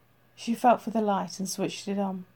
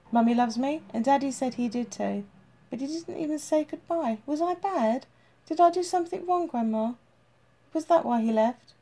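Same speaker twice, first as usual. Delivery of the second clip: put-on higher, pleading child's voice; a small boy asking grandma if he was bad; child voice rising into upset questions; hurt little boy; 5 semitones higher than usual. Child Tim asks his grandmother.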